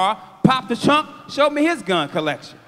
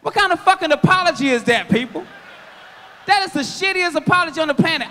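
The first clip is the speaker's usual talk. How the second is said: loud, fast, laughing outrage; incredulous rant; 8 semitones higher, 4 dB louder, faster than usual. Real, not acted. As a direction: shout it at the crowd, cracking up. Ranting at the audience.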